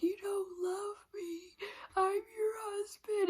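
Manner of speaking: mocking, drawn-out crybaby wail; mock sobbing. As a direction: imitate him blubbering for his wife. Husband crying, retold.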